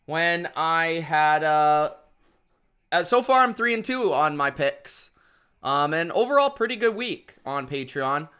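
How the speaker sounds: usual speaking voice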